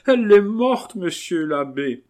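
high, loud wail dropping off; acted sobbing. Marquise announcing the death, high wailing cry. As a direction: burst out in theatrical grief. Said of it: "s'écria la marquise en sanglotant".

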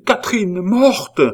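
high, loud shout of a name; horrified cry. A narrator's reading. A man finding Catherine's body, crying out high and loud. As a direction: call out in horror. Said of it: "s'écria-t-il".

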